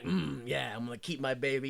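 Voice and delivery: soft, low, self-satisfied quote; contented murmur. Retold or imitated. Hess resolving to keep the baby, softly pleased. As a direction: sink low and quiet, as if privately pleased.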